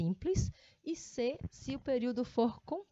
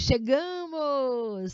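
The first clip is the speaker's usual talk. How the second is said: "chegamos!" drawn out slow and louder with emotion; exclamation; 3 semitones higher, 6 dB louder, much slower than usual. Shown as a demonstration. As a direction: exclaim "chegamos!" long and loud. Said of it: "Você lê nesse tom".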